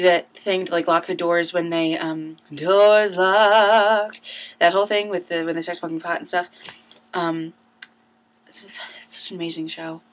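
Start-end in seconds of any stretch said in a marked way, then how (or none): 2.5-4.2 louder mock call of a stage line. Quoting the actor's stage line. 8.5-10.0 soft breathy whisper of awe. Real, not acted. Hushed awe about the show.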